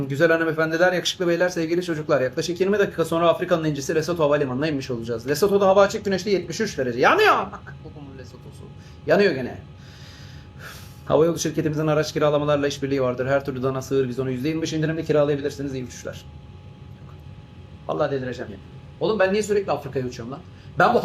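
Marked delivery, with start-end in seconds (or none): 0.1-7.0 a tired rerun of the cabin announcement; captain made to read the announcement again, wearily; pilot announcement. Acted. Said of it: "Ver anonsu, ver". 7.0-7.4 high, loud startled cry; shocked yelp breaking into the announcement; sudden alarm. Acted. 7.8-16.2 a tired rerun of the cabin announcement; captain made to read the announcement again, wearily; pilot announcement. Acted. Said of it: "Ver anonsu, ver".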